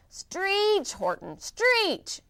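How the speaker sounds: high, drawn out shout; yelling bug. Acted. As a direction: urge him on harder. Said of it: "yelled the bug".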